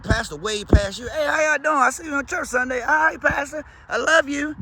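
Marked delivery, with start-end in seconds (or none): 1.1-2.8 smug pastor greeting. Retold or imitated. Pastor greeting from his Bentley. 2.8-4.6 high, loud, gushing congregant voice; mock adoring churchgoer. Church member answering the pastor.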